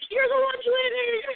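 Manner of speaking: high-pitched drawn-out holler; mock excited yell. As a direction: call it out high and stretched, imitating a stranger. Mimics stranger yelling from next car.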